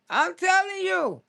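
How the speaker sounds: normal talking voice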